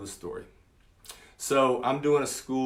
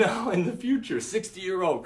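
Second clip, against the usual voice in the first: laughing insistence; 6 semitones higher than usual. Retold or imitated. Coaxing the kid, laughing.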